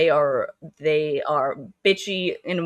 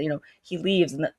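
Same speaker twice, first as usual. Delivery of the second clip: normal talking voice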